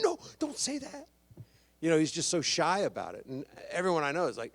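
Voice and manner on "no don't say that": high, bashful brush-off; lightly voiced shy actor, much higher; shy protest. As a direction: wave off the praise shyly. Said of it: "he went".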